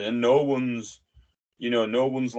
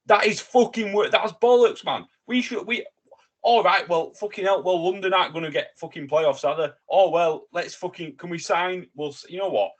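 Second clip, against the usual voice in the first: high-pitched, exasperated outburst; indignant rant; 7 semitones higher, 3 dB louder than usual. Real, not acted. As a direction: rant in real disbelief at a dodgy deal. Indignant rant about the loan deal.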